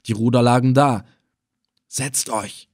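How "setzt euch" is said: much softer hushed command; low voice. Acted. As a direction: say it softly so no one hears. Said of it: "gebot ich mit leiser Stimme". (mit leiser Stimme gebieten)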